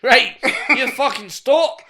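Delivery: high, slow bellow; hammed-up yell of exasperation; mock angry shout. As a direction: lay down the law, playfully shouting. Acted family row over accents.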